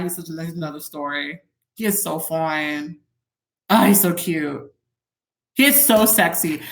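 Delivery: usual speaking voice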